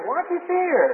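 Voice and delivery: Jesus asking. Retold or imitated. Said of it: "Jesus said".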